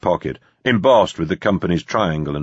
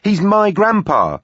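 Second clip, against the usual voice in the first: high, slow, indignant protest; outraged; 14 semitones higher, 4 dB louder than usual. Acted. Granddaughter defending her loyalty to grandpa, outraged. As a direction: drag it out, deeply affronted. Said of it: "high-energy outrage".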